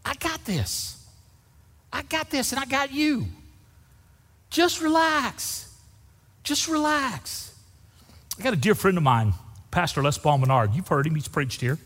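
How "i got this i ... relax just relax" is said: high, soft and slow, calming; soothing Jesus reassuring his frightened disciples. Acted. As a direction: hush them gently: I got this. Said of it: "but he's saying".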